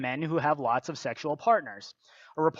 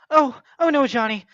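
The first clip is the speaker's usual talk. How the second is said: high, prissy mock girl voice, hammed-up; mock girlfriend voice; 7 semitones higher, 7 dB louder than usual. Mocking the girlfriend as she fends off her boyfriend, high mock girl voice. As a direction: play the naive girlfriend, higher and sweet. Acted teen couple, girl's voice.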